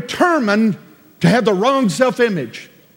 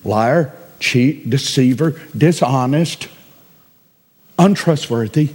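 usual speaking voice

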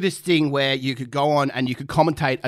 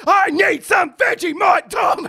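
high, loud, drawn-out yell; re-enacted slow high scream; 13 semitones higher, 5 dB louder, slower than usual. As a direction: bellow each word at the commentator. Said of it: "I'm screaming".

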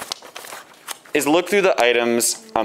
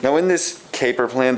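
ordinary talk, nothing marked

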